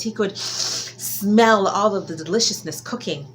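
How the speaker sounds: usual speaking voice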